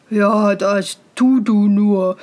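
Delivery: put-on slow, condescending older-brother voice; mocking brothers. A narrator's reading. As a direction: humour the fool with slow scorn. Brothers mock him.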